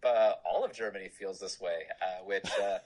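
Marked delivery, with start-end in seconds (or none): none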